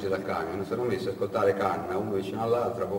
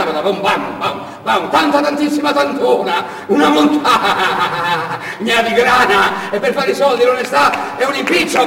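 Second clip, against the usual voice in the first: a bragging driver telling tall tales, words tumbling out; chatty truck driver Canna; 11 semitones higher, 8 dB louder than usual. Acted. Canna talks over the bumping truck. (camionista chiacchierone e vanaglorioso, voce più alta e forte)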